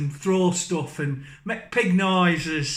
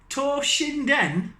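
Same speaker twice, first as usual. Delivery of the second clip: Toshinden drawn out slowly and pitched up; funny accent; 7 semitones higher, much slower than usual. Shown as a demonstration. Reading game names to viewers in a silly, slow, high voice. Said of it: "in a really funny accent".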